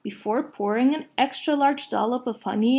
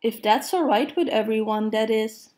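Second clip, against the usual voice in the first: usual speaking voice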